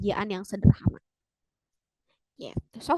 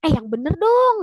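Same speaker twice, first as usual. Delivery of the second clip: loud, high incredulous exclamation; acted astonished protest; disbelief; 5 semitones higher, 7 dB louder than usual. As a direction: insist it can't be true. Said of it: "Gak nyangka gitu ya".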